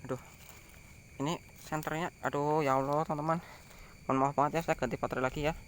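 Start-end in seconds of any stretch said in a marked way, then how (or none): none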